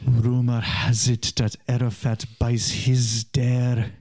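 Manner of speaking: put-on slow, lightly German-accented documentary narrator; a hint of a solemn German filmmaker; Werner Herzog. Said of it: "That was my Werner Herzog".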